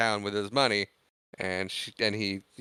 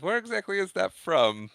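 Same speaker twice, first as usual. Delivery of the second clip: imitated teasing, pitched up; mocking question; 9 semitones higher than usual. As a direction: poke fun with a higher-pitched question. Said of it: "he makes fun of her accent".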